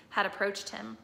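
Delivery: usual speaking voice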